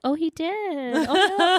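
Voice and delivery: high-pitched gullible little "oh no"; credulous dismay. Retold or imitated. Listener believes her co-host's fake death news, mock dismay. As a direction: take it in as sad news, voice rising. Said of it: "this is how much I trust Courtney".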